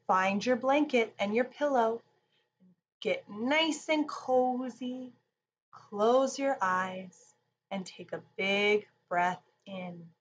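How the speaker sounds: usual speaking voice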